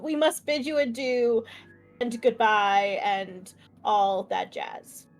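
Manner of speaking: ordinary talk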